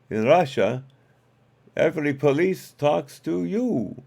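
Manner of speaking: Russian reversal joke in a hammed-up Russian accent; comic stage Russian accent. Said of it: "my Russian accent used to be pretty good".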